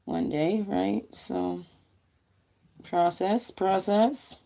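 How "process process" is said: usual speaking voice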